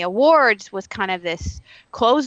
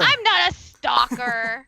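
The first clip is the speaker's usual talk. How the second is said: slow, drawn-out playful denial; hammed-up indignation; mock indignant protest; 4 semitones higher, much slower than usual. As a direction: protest the teasing, higher and stretched out. Mock protest at being teased.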